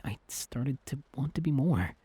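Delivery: usual speaking voice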